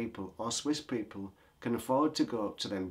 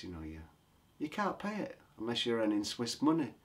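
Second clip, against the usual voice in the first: normal talking voice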